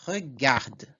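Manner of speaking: regarde with the final E silent; teacher models regarde for the class; French pronunciation demo. Shown as a demonstration. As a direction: say regarde ending on the D, the E left mute. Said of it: "a minha pronúncia vai ser".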